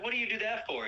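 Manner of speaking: high-pitched mock indignation; mad. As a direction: imitate an outraged Santa without shouting. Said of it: "Santa gets all mad".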